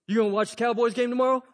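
quick, mock-casual deflection; hasty subject change. Big guy to the pastor, hastily changing the subject. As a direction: blurt it fast to dodge the awkwardness.

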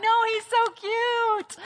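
high, drawn-out gushing squeal of affection; adoring coo. Acted. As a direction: coo over a tiny cute animal. Crowd cooing over a cute otter.

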